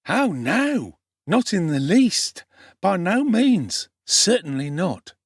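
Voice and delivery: lightly voiced urchin, playfully ironic, slow; a hint of a teasing young pickpocket, dragging it out; mock-serious street boy. The Dodger teasing Oliver, slow and playfully ironic.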